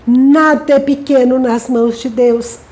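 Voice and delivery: normal talking voice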